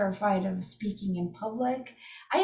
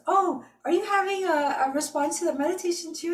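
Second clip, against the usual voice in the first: bright, much higher lady's voice, asking gently; friendly stranger; 8 semitones higher, 3 dB louder than usual. Retold or imitated. Stranger in the bathroom asking if she's okay, high and friendly. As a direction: chirp it up high like a curious lady. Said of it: "And some lady goes".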